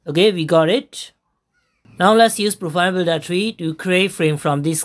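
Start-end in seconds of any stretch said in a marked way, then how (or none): none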